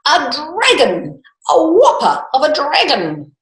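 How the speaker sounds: high, loud astonished cry; king spotting a huge dragon, amazed. A narrator's reading. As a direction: gasp it out in wonder. King sees dragon, exclaims.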